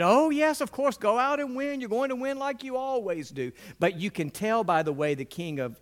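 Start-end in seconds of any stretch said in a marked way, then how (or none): 0.0-3.5 much higher, loud mocking falsetto; exaggerated sarcastic prophet. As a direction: sneer it out in a high, mocking voice. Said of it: "in a sarcastic tone".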